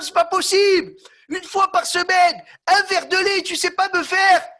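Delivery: high, loud exasperated scolding; angry husband. Acted. Husband scolding his wife over milk, high and loud. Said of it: "Il s'énerve".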